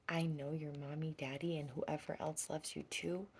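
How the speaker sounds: ordinary talk, nothing marked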